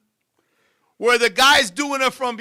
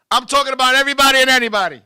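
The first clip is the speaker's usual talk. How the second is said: ordinary talk, nothing marked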